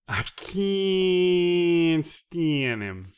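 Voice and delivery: loud, very slow, stretched out; loud drawn-out. Shown as a demonstration. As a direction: belt it out slowly. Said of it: "No, no, no".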